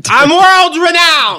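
brash Brooklyn tough-guy swagger; cocky Noo Yawk comic; Andrew Dice Clay. Retold or imitated. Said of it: "King of a diminishing return".

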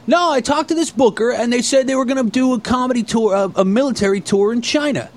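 high, naive insistence; Ray voice. Retold or imitated. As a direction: imitate a clueless guy defending himself. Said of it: "And he goes".

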